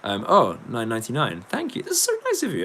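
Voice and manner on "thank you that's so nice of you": squeaky thanks. Real, not acted. Thanking a donor on stream.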